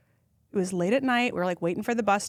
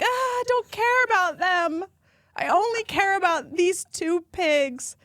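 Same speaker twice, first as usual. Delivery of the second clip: still tearful; 10 semitones higher, 3 dB louder, slower than usual. Retold or imitated. Still crying over pigs.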